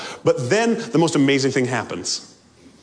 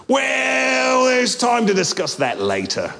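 nervous ghost brushing it off; evasive ghost; 7 semitones higher than usual. Acted. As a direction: ghost pleading to keep his lizard. Ghost dodging angel.

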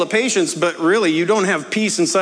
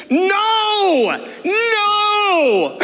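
yelling reenacted; 13 semitones higher than usual. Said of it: "And so this is me".

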